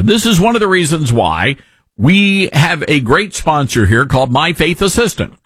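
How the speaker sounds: ordinary delivery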